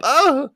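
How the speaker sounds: high, loud shocked cry; gasp of shock. Real, not acted. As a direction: let out a startled yelp. Reacting to the story twist.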